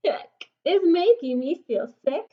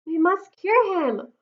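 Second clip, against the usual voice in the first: ordinary delivery, nothing marked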